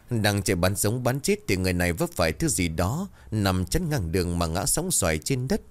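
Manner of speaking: plain reading voice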